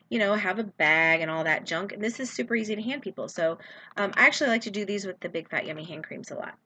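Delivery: usual speaking voice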